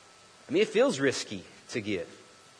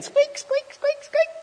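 very high, louder strained squeaks of a rusty pump; squealing rusty pump, pushed higher and louder; 5 dB louder, slower than usual. Retold or imitated. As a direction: crank out the squeaks, straining harder. Pump squeaking again, harder.

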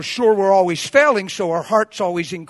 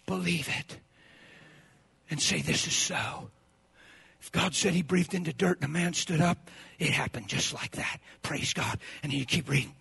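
very soft, low delivery; low hushed awe; hushed sincerity; 4 semitones lower, 10 dB quieter than usual. Real, not acted. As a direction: drop right down to a quiet, intimate urging. Hushed aside urging belief.

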